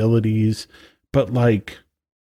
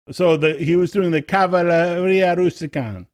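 put-on Italian accent; 6 semitones higher than usual. Keeps the Italian voice going.

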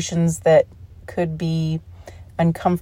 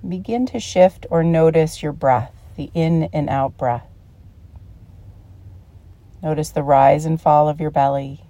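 calm meditation guide with long pauses; unhurried, soothing guided-relaxation voice. Real, not acted. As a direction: ease them into relaxation, phrase by phrase.

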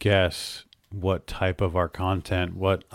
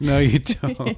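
higher, louder playful no you don't; teasing retort; 7 semitones higher, 5 dB louder, slower than usual. Real, not acted. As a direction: shoot it back at her, teasing. Teasing argument over saying W's.

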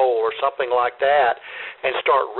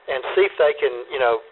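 normal talking voice

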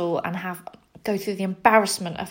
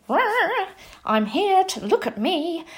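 much higher, hammed-up show-off cry; ridiculous show-off; 11 semitones higher than usual. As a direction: play the silly attention seeker. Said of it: "this ridiculous".

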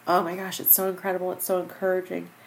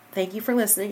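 ordinary talk

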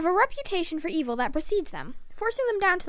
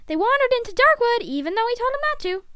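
higher, mounting annoyance; exasperated; 9 semitones higher, 5 dB louder, faster than usual. Acted. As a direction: list their offences with growing irritation. Mother lists adventurers' misdeeds, exasperated.

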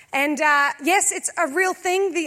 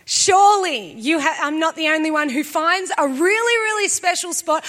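usual speaking voice

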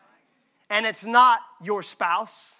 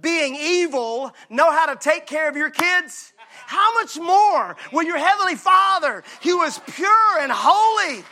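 rising to a loud, higher preaching shout; preaching Jesus' words; 4 semitones higher, 3 dB louder than usual. Acted. Preacher building to a shout quoting Jesus to the church. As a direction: build it up and proclaim. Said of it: "Jesus said".

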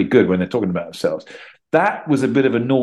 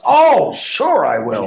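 drawn-out, higher "oh, sure"; eager agreement; 6 semitones higher, 4 dB louder, much slower than usual. Acted. Actor replying to a podcast favor, mock eager. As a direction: gush a long, over-keen yes.

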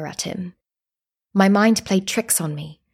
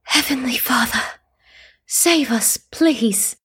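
desperate plea; 5 semitones higher, slower than usual. A narrator's reading. Desperate prayer in the snow.

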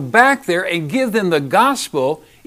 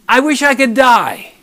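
pouting Jonah; 6 semitones higher, 7 dB louder than usual. Retold or imitated. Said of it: "Jonah got mad, began to pout".

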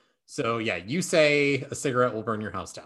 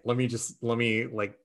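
ordinary talk

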